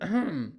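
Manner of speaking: loud throat clear used as a name. Shown as a demonstration. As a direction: hack out a throat clear like calling someone. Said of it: "that's how it sounds".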